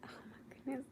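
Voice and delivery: whispered oh my goodness. Real, not acted. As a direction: breathe it out in a whisper. Whispers to chat at night.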